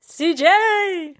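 high excited call of "C J!". Real, not acted. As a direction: call out the name with delight. Excited greeting of a friend.

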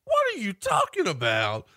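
high-pitched phony innocence; voice going up. Shown as a demonstration. Host showing how liars sound, high mock innocence. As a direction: act guilty while squeaking your denial. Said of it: "when their voice goes up".

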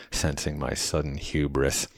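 usual speaking voice

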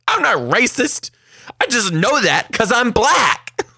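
high, loud, slow protest; imitating a kid firing back indignantly. Kid denying racism to classmate, high indignant protest. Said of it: "He goes".

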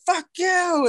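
high replayed yell; retold shout. Telling his friend what he yelled, high mock shout. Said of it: "Like, I said".

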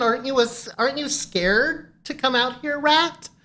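speaking to a rat. Retold or imitated. Talking to the rat.

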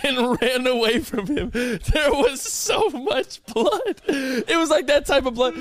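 laughing through the story; can barely get the words out for laughing. Real, not acted. Friend laughing while recalling the bloody mouth.

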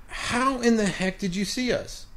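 high-pitched, incredulous question; astonished disbelief. Retold or imitated. Caught player asking the manager how, incredulous. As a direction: ask it high, utterly mystified.

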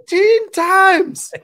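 very high, loud, drawn-out Scottish shout; Robin Williams. Acted. Comedy bit on inventing golf, shouted in outraged Scottish. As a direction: yell it slowly in a Scottish accent. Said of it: "Robin Williams describing golf ... in a Scottish accent".

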